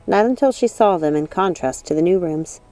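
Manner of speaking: usual speaking voice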